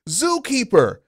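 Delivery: high shouted call; mock shout. Acted shout in a boardroom.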